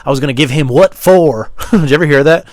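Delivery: heated, loud retelling; worked-up storytelling. Acted. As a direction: tell it fired up and emphatic. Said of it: "telling the story like this".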